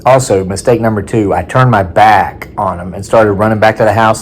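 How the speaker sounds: normal talking voice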